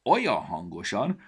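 plain reading voice

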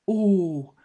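high, loud startled oh; high sudden gasp of surprise. Acted. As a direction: stop short in surprise. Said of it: "torpant meg a szemüveg".

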